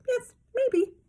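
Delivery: very high puppet voice; Muppet voice. A narrator's reading. Toddler answering Dad as Bert, very high puppet voice. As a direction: squeak it like a Muppet.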